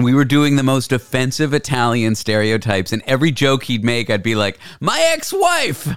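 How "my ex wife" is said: Tony Pepperoni. Retold or imitated. Retelling his Burning Man radio bit, mock Italian character. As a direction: ham up a mock Italian wise guy. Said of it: "I made a character named Tony Pepperoni".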